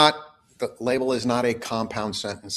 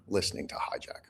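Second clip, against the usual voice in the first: whisper; 3 semitones lower, 11 dB quieter than usual. Real, not acted. Pointed aside ending the advice.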